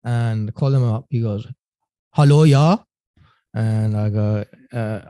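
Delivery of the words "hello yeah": put-on loud German-accented phone greeting; thick German accent, rising at the end. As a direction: answer brightly in a heavy German accent. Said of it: "Thick German accent".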